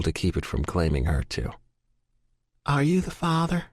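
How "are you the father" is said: gentle Southern nurse, voice pitched higher; soft Southern accent. A narrator's reading. Nurse asks the waiting man, soft Southern accent. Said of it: "the soft Southern accent ask".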